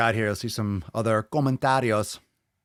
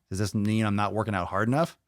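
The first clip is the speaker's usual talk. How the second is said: ordinary talk, nothing marked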